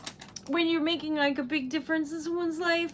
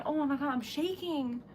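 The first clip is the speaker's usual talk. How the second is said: normal talking voice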